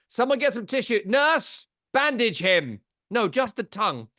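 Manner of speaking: mock emergency call. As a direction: shout for the nurse in mock panic. Mock emergency over sweating cohost.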